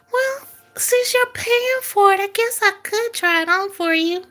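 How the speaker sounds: high teasing come-on; coy girl voice. Acted. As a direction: play the coy girl angling for gifts.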